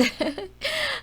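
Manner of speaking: high giggling laugh; a bright childlike giggle; Little Bear bursts out laughing. A narrator's reading. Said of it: "Little Bear laughed".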